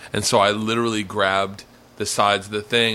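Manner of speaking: ordinary talk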